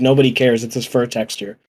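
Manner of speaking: ordinary delivery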